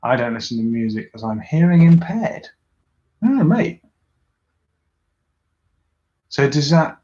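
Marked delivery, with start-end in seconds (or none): 3.2-3.8 much higher, louder oh mate; sympathetic surprise. Real, not acted. Reacting to a viewer's hearing loss, much higher. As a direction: caught off guard with sympathy.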